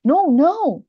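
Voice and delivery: both words of "No, no" punched high and loud; emphatic negation. Shown as a demonstration. Teacher demonstrates emphasizing negation, a loud, high, emphatic "No, no". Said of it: "emphasizing the negation bit of it".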